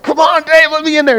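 pushing, pleading. Acted. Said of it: "and I'm like".

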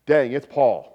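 loud, starstruck gasp of recognition; awed exclamation. Pretend. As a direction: blurt it like a fan seeing a hero. Congregation awed at Paul.

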